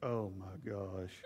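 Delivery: low, slow, soft mortified groan; mortified teen. Retold or imitated. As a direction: sink into embarrassment. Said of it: "he'd look up and go".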